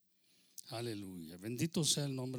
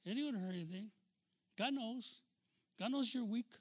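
high, coaxing mock reassurance; tempter's voice; 7 semitones higher than usual. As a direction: coax them in a raised, lighter voice, excusing their weakness. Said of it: "And he's telling you". (voz tentadora, aguda y consoladora)

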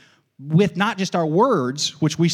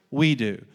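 low, settled response; catechism answer; 3 semitones lower than usual. Shown as a demonstration. Preacher gives the congregation's answer, catechism response. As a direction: drop "We do" well down in pitch. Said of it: "I'm catechizing you".

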